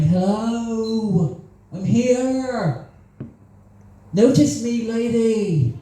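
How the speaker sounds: high, pleading lovesick voice, slow on "We could date"; mock lovestruck plea. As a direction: beg for her attention like a hopeless admirer. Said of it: "just going".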